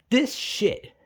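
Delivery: ordinary talk, nothing marked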